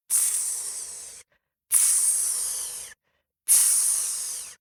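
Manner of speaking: soft unvoiced hiss of coals. A narrator's reading. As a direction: let the coals sizzle quietly. Glowing coals on the fence.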